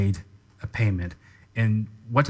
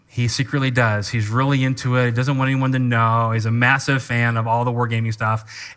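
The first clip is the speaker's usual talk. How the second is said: ordinary talk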